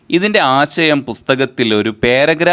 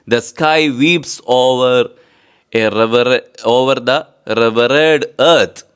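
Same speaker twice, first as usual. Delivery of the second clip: slow dictation; much slower than usual. Shown as a demonstration.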